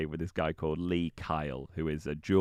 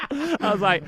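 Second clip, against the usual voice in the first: laughing; 7 dB louder than usual. Real, not acted. Laughing through the punchline.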